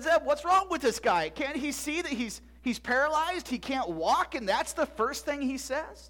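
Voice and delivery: high, incredulous protest; indignant disbelief. Acted. Boy protesting to Zeb about the paralyzed man, incredulous. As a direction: protest in disbelief, slowing on the question.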